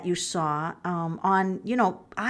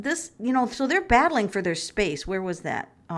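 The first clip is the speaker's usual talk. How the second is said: ordinary delivery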